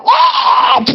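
sudden high ah; a cry as the spirit strikes; mock shriek. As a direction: yelp in alarm. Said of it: "all of a sudden he's going".

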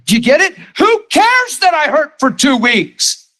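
a preacher deliberately raising his voice to drive the point home. Real, not acted. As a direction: lift the voice to stop the room and make a point. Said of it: "I'm raising my voice on a purpose".

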